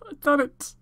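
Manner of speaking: joyful yell of victory; shouted. Real, not acted. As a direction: let it burst out after the whisper. Shouted after the whisper.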